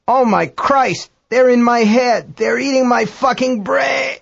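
very high, drawn-out cry of agony; panicked American astronaut. A narrator's reading. American astronaut being eaten by worms, high horrified wail. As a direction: wail it in mounting horror. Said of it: "American voice".

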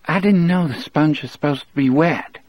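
dazed, mechanical rehearsed line; faint mechanical voice. Acted. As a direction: recite it like a numb machine. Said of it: "in a faint, mechanical voice".

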